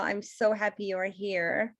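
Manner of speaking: ordinary talk, nothing marked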